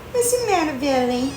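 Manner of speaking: high sing-song pet voice. Real, not acted. Cooing to the cat.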